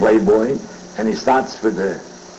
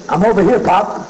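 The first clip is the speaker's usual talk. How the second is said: put-on kid's reply; 9 semitones higher than usual. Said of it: "The kid says".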